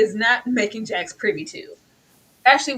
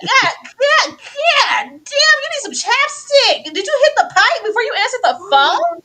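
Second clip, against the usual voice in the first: loud, high-pitched comic outrage; mock horror; 12 semitones higher, 8 dB louder than usual. Podcaster roasting a man's chapped lips, mock horror. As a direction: wail in disbelief through laughter. Acting out horrified reaction to lips.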